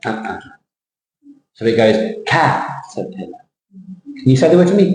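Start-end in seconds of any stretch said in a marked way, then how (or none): 2.3-3.4 caterpillar with a big CAT and a small, receding tail; low caterpillar, weight up front; stress on first syllable. Shown as a demonstration. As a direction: hit CAT in caterpillar, tuck away -erpillar. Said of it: "they're recessive".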